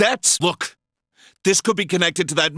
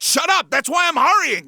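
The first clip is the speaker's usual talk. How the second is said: angry shout; 7 semitones higher than usual. Acted. Angry father cuts Adachi off.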